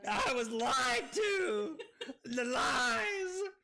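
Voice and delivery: shouting through real laughter; laughing shout. Real, not acted. Streamer tricked into drinking vodka, laughing shout. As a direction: cry it out between laughs, slowed down.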